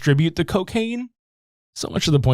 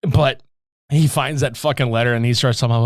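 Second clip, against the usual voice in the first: ordinary talk, nothing marked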